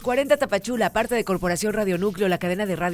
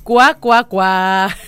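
duck quack; 9 dB louder, much slower than usual. Retold or imitated. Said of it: "Dice que le pongas el cuá, cuá, cuá".

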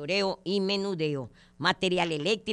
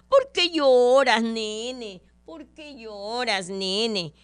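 higher, louder, slow mothering voice; fussing mother; 4 semitones higher, 6 dB louder, much slower than usual. Acted. Mother asking her crying boy what's wrong, coddling. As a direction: coo over the crying little boy.

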